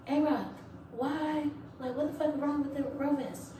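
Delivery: high, sharp scolding voice of a superior; mock the officer snapping at her; petty officer scolding. Officer scolding the recruit over the vest, high and sharp. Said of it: "she was like".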